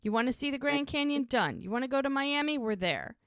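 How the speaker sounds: high, lilting sales pitch dropping on each payoff; eager persuasion. Retold or imitated. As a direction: voice a boy selling an adventure. Boyfriend pitches a road trip.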